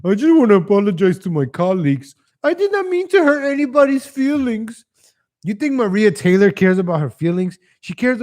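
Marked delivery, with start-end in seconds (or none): 0.0-4.8 much higher, louder mock-sincere apology. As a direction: parody a sugary public apology. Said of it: "She's like".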